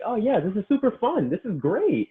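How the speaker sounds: high, bright mock enthusiasm; mock cheerful client. Coach mimicking a client who now loves jump squats, high mock cheer. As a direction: squeak it out like a giddy convert.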